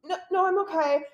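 high, imitated nervous refusal; starstruck. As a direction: act out a scared fan brushing off the offer. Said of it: "she was just so starstruck".

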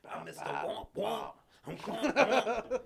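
mock rap scream, climbing to a drawn-out high rasp; raspy screaming voice. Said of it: "raspy fucking screaming voice".

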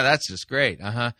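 ordinary talk, nothing marked

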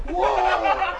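loud imitation of startled kids. Imitating little kids hearing the tape, startled. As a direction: blurt it like a startled child. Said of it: "they go like".

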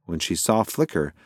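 ordinary delivery, nothing marked

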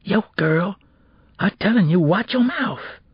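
put-on high-pitched, menacing street boy; ominous warning. A narrator's reading. Boy warning classmate to stop accusing him, ominous. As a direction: warn her off, slowing at the end. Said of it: "he whispered ominously".

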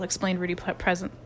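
plain reading voice

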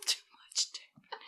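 hushed echo whisper of "Too much dick". Retold or imitated. Echoing the penalty call, slow whisper. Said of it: "five-inch penalty".

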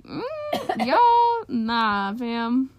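dismissive. Retold or imitated. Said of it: "I was like".

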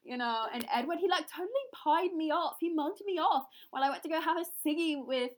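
high, animated Love Island Scouse; attempted Scouse. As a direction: gossip like a reality contestant in broad Scouse. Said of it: "That's Scouse, if you're wondering, or an attempt".

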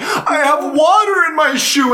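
high, whiny mock complaint; mock whimper. Wounded soldier explaining why he can't run, high whiny voice. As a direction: whine it like a pathetic kid. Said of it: "He's, like, crying".

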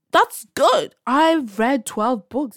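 excited burst. Real, not acted. Cheering own reading goal.

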